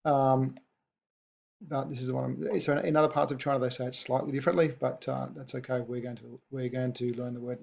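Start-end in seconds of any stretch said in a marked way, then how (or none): none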